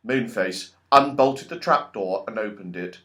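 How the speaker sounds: ordinary delivery, nothing marked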